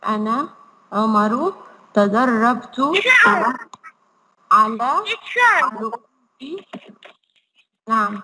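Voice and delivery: put-on slow syllable-by-syllable reading for children; slow model reading. Shown as a demonstration. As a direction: read each word slowly so the kids can repeat. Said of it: "اقرأوا معايا وقولوا معايا". (قراءة بطيئة واضحة للأطفال)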